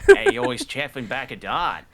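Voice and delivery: put-on American trying out a broad Australian accent; near-miss Australian accent, banter about a bloke having a smoke. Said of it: "so close to getting a very good Australian accent".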